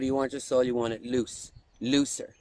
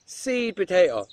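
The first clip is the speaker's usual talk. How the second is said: ordinary delivery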